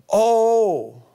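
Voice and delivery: a sudden gasp of amazement; surprised oh. Retold or imitated. Peter seeing the net full of fish, amazed.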